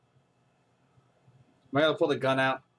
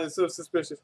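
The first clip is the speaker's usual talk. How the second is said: normal talking voice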